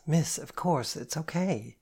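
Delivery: plain reading voice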